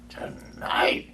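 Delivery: whispered in shock; alarmed whisper. Acted. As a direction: breathe it out, horrified. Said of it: "cried Scrooge".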